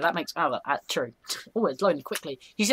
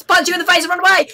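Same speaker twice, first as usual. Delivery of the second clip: fast, high, loud burst; excited mischievous shout; gleeful prank reveal; 9 semitones higher, 10 dB louder, faster than usual. Pretend. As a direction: yell it while making off. Springs prank on friend.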